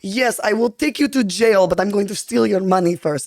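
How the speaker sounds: badass Russian villain. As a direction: play a sultry Russian screen villain. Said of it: "like, the badass Russians".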